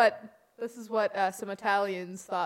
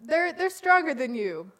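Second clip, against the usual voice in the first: quoting a play; 5 semitones higher, 8 dB louder than usual. Retold or imitated. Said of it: "bad puns in The Wiz".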